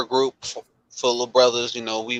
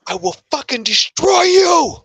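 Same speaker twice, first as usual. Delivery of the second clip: imitating a furious rant; 6 semitones higher than usual. Coworker retelling a vet's outburst at managers, building to an imitated furious yell. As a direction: mimic a vet who finally snaps at his managers. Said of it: "But he's like".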